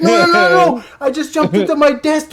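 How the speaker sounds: high, pleading mock panic; acted-out panicked denial; frantic protest. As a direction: cut in high and rapid to calm someone down. Teller frantically calming boss.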